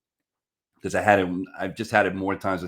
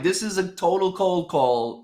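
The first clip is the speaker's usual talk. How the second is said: pitched up, slow and emphatic; high earnest insistence; 9 semitones higher, slower than usual. Retold or imitated. Insisting to the prospect it's a real cold call, pitched high and slow. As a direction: plead that it's honest. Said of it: "I'm like".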